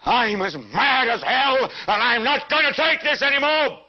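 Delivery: full-throated rallying cry; furious yell. Acted. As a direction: yell it out the window to the whole street. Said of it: "stick your head out and yell".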